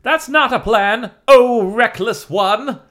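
high, loud, slow sneer; mock him with a drawn-out sneer; snide. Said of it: "[name] said snidely".

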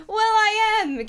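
high, loud, slow witchy boast; hammed-up witch voice; proud declaration. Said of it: "Catherine declared".

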